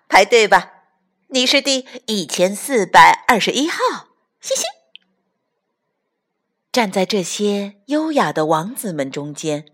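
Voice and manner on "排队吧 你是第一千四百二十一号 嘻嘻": mocking laughter. Frogs mock with laughter.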